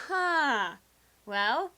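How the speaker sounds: ordinary talk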